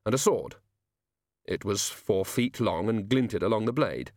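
ordinary delivery

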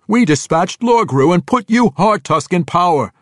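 high, loud, insistent protest; arguing captive. A narrator's reading. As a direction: plead your case at full voice. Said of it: "the dark elf argued".

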